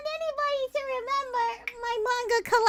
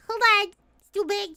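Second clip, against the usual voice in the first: ordinary talk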